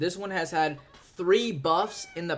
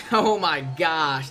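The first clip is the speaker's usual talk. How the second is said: laughing through the words; much slower than usual. Real, not acted. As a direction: chuckle it out slowly. Laughs at goblins.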